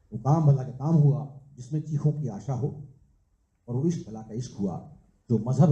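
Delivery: measured, rhythmic verse with a lilting refrain; live poetry recital; poem recitation. Acted. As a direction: recite it as a couplet, landing on each rhyme.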